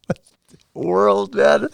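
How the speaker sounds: reacting as if he can't believe it; a husband amazed that his sick wife wants to go walk the halls; stunned disbelief. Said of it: "I was like".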